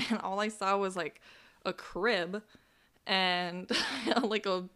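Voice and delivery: real nervous giggles breaking into the words; apologetic laughter mid-story; laughing while telling it. Real, not acted. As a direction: talk through helpless little laughs. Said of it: "Sorry, I'm laughing".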